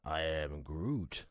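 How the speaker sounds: very low, slow and soft Groot; Groot impression. Mocking Vin Diesel as Groot, very low and slow. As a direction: rumble it out slow and deep. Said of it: "Are you getting a Vin Diesel?".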